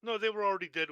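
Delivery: loud, fast protest. Real, not acted. Player protesting the GM's rule, fast and loud. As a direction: blurt it out fast and loud to defend yourself.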